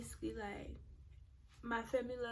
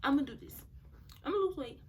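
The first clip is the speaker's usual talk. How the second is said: higher, louder retold resolve; determined; 3 semitones higher, 5 dB louder, much faster than usual. Speaker replaying her vow to lose weight, determined. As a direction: declare it like a self-promise.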